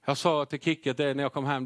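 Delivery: ordinary talk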